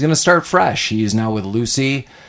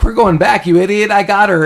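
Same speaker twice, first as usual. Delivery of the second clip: higher, loud impatient call; mock exasperated shout; 8 semitones higher, 3 dB louder than usual. Johnny and Lucy yelling back at Frank, mock exasperated. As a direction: snap it at him like he's an idiot. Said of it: "Johnny and Lucy are like".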